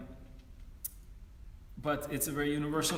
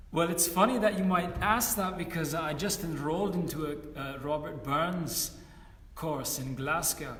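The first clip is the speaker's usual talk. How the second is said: bad Scottish accent; 3 semitones lower than usual. Scottish friend answering a question about Robert Burns, in a bad Scottish accent. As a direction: do a terrible Scottish impression of a friend. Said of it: "that was a terrible Scottish accent".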